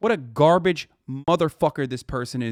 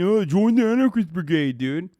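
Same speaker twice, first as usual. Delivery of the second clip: mocking. Mocking a chat user's words.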